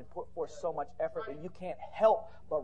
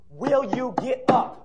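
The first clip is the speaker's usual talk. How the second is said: slow, loud, higher-pitched challenge; shouted challenge; 6 semitones higher, 10 dB louder, much slower than usual. Real, not acted. Preacher asking fallen listeners to rise, slow shouted challenge. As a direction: shout each word separately at the crowd.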